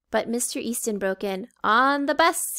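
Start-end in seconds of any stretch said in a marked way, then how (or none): 1.6-2.6 put-on higher, louder call to the kids. A narrator's reading. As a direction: call the class onto the bus. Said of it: "he called".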